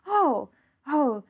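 bright sigh of relief; relieved Avery. Acted. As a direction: let the relief flood out.

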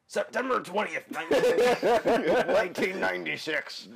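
high, loud hammed-up pirate. Shown as a demonstration. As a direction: ham it up like a cartoon pirate. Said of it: "Now I'm actually gonna read it like a perp".

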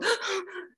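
sharp high startled gasp; mock gasp. Showing how a startled body reacts, a high gasp. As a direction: gasp like a body pulling back. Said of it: "somebody go".

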